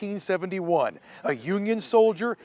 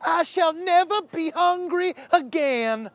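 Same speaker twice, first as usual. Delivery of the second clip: high, slow mock Southern declaration; host imitating Scarlett O'Hara, slow and high; 11 semitones higher, slower than usual. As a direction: proclaim it like a Southern belle in a movie. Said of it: "a little Scarlett O'Hara in all of us".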